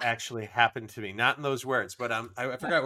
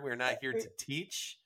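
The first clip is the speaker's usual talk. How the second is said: ordinary delivery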